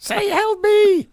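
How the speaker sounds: very high, squeaky cry for help; tiny scientist-turned-bug. Acted. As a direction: squeal it like a tiny trapped creature. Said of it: "turned back into the scientist".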